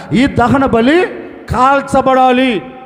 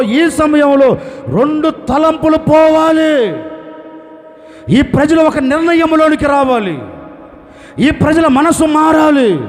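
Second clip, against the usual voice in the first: loud, slow, building prayer rising to a shout; fervent prayer; 3 semitones higher, 4 dB louder than usual. Retold or imitated. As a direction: plead with God, growing in force. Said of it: "ఒకే ప్రార్థన చేస్తున్నాడు". (బిగ్గరగా, ఉద్వేగంగా చేసే ప్రార్థన)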